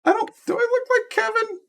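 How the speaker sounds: a high, breathless do-I-really question; dismayed squeak. Real, not acted. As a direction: squeak it in mock horror. Sees character resembles him.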